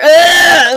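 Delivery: loud, hammed-up moan; mock sexual moan. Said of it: "a sexual moan after".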